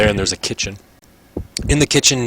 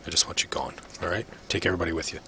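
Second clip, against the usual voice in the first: very soft, low, callous guard; hushed and uncaring, trailing low; indifferent guard; 4 semitones lower, 12 dB quieter, faster than usual. Acted. GM voices guard Drolin.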